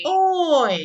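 loud high model "Oi"; emphatic demo. Shown as a demonstration. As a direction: belt out "Oi" as a model. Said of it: "gitu ya". ("Oi" diucapkan keras dan tinggi)

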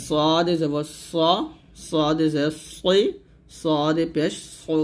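slow, drawn-out "সদ এ জবর স" with a full, heavy s; heavy Arabic Sad. Shown as a demonstration. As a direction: stretch "সদ", "সই", "সো" with a thick emphatic s. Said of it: "এরকম মোটা করে বলতে হবে". (মোটা করে টেনে উচ্চারণ)

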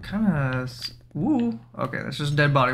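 ordinary talk, nothing marked

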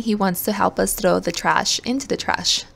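normal talking voice

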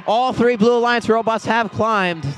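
excited commentary. Real, not acted. Commentator calling all three robots climbing, excited. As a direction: lift the voice for a big play.

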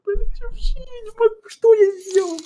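high whiny fake sobbing; fake crying. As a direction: put on a crying girl voice. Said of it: "изображаешь плачущую девочку".

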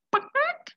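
very high squawky bawk bawk; chicken clucking. Acted. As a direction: cluck like a silly hen for kids. Chicken puppet clucks at friends.

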